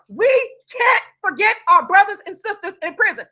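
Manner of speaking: ordinary talk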